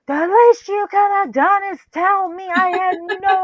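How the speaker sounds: high, loud indignant mock yell. Dad scolds them for not telling him, yelling. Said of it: "starts yelling at us".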